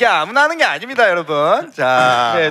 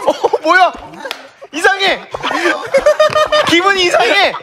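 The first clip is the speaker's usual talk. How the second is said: laughing; 11 semitones higher than usual. Real, not acted. Strange feeling while trying it.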